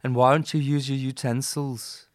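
imitated Liverpudlian scolding; Liverpool accent. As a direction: nag in a put-on Liverpool accent.

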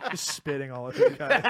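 normal talking voice

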